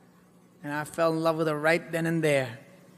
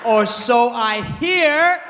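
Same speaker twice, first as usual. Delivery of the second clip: slow, loud deadpan shout; loud punchline; 4 semitones higher, 5 dB louder, much slower than usual. Acted. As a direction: hammer the punchline slowly. Punchline delivered big.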